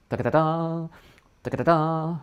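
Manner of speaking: crisp repeated da-da-da-da; fanfare-style syllables; fanfare tonguing. Shown as a demonstration. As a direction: model a fanfare rhythm. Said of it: "fanfare tonguing".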